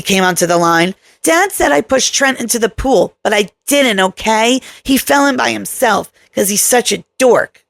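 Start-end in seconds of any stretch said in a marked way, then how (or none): 1.2-7.6 put-on whiny little girl. Daughter complains about brother.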